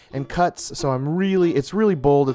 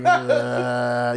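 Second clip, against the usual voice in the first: lightly voiced zombie groan; a hint of a zombie moan; 2 semitones lower than usual. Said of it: "you to do a zombie noise".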